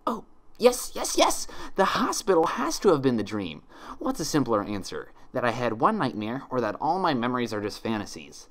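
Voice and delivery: high, giddy rush of relief; joyful excitement. A narrator's reading. Twilight convincing herself she's home, high giddy joy. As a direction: let the joy spill over.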